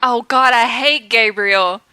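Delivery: usual speaking voice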